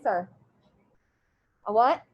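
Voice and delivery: ordinary delivery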